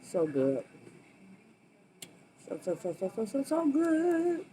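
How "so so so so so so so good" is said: delighted. Real, not acted. Savoring food on camera.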